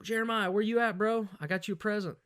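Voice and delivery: high excited call to Jeremiah. Retold or imitated. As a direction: shout for your buddy with a surprise. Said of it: "I was like".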